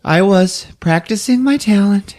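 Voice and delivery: high, slow put-on voice; halting, drawn-out phrases as a comic impression; imitated pageant contestant. [name] telling how she prepared for pageant, put-on voice. Acts out anorexic contestant's interview.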